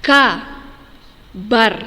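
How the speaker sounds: teacher modelling the word Kabar; clear model word. Shown as a demonstration. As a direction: say Kabar clearly and deliberately for children to copy. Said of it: "kita perhatikan pengucapannya".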